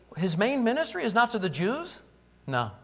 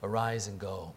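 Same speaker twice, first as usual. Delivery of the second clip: low and soft, settled; quiet command; 9 semitones lower, 8 dB quieter than usual. Acted. The Lord sending Ananias, low and soft. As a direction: say it low like a final word. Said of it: "and he says".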